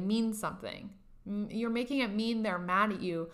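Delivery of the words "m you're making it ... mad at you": normal talking voice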